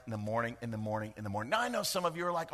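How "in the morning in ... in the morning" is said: each repeat softer and lower; morning, morning, fading out. Shown as a demonstration. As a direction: let the phrase echo away. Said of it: "first we see in the morning".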